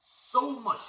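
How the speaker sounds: "So much" very high, falling; emphasis on so much. Shown as a demonstration. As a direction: hit "So much" hard. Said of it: "We gotta read it with emphasis".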